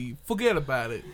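hammed-up Brooklyn accent; quick tough-guy Brooklyn voice. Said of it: "we gotta do a Brooklyn accent".

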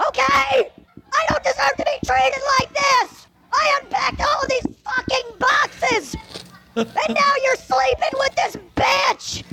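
high furious shouting. Acted. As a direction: scream it at him. Wife explodes at husband.

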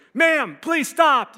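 high, loud, drawn-out shout from behind; men's yell. Retold or imitated. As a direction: imitate guards yelling to halt someone. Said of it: "these men's voice yell from behind us".

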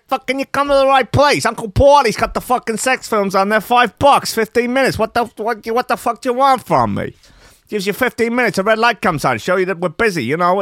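high, loud, hammed-up Italian shop owner accent; gross Italian accent. Shop owner telling The Sun, in hammed-up Italian accent. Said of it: "I'm gonna do a really gross Italian accent".

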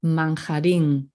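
Manjarín with a throaty j, like "man-ha-REEN" with a rasp; Spanish pronunciation. Shown as a demonstration. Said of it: "Okay, manjarín".

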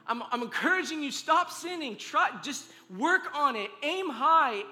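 loud, high, urgent preaching over music; full-force preaching. Acted. Pastor urging the congregation over music, full-force preaching. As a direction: drive it home to the church like a climax.

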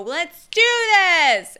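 loud and high, slowed right down; big opening rally cry; hype shout. Real, not acted. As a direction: fire up the room. Hyping up the crowd at start.

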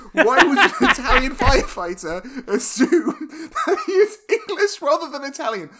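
high, loud protest through laughter; incredulous laughter. Real, not acted. Co-host mocking the Italian firefighters scene, laughing incredulously. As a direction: laugh in disbelief while complaining. Laughing disbelief at film logic.

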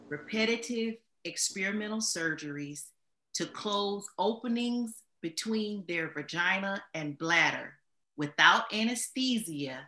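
soft, measured delivery with pauses between phrases; slow and deliberate. Real, not acted. Guest explains who the enslaved women were, slowly and deliberately. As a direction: lay it out slowly so every fact sinks in. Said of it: "I wanted to say it slowly".

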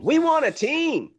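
high, loud, drawn-out mock demand; mock clamouring. Host voices Salt Lake City wanting a team, mock clamouring. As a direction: pipe up like a city begging for a team.

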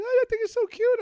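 very high cooing mimicry; gushing schoolgirls. Junior high girls loving his lisp, high mock gushing. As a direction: put on a squeaky, adoring girl voice. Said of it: "the girls you".

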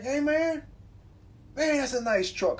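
a hint of a friendly stranger; lightly voiced passerby. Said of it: "it's like".

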